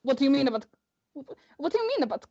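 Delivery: put-on halting stab at Kriv's accent; attempted character accent. Game master voicing Kriv's reply, unsteady attempted accent. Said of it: "I can do this accent".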